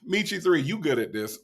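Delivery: ordinary talk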